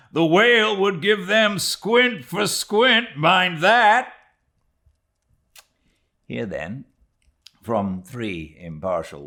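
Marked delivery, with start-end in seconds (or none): 0.1-4.1 very high, loud, gleeful sailor's boast; Flask, cocky sailor. Acted. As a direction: crow about it with a laugh.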